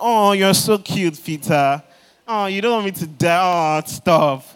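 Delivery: loud, slow, mocking sweetness; mock cooing. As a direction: coo it like you'd pet a child. Mock soft Jesus reply, loud.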